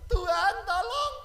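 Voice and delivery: slow, high, loud half-laughing cry to God; hammed-up desperate plea; new convert pleading. Jiwa baru minta tolong. (seruan minta tolong jiwa baru, lambat dan tinggi)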